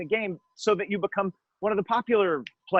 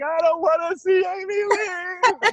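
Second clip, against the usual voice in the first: mock weeping; 12 semitones higher than usual. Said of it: "and then you're weeping".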